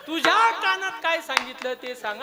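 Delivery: high-pitched heated questioning; insistent demand. Acted. Kauravas press the brother about the secret, insistent demand. Repeated demand in acted quarrel.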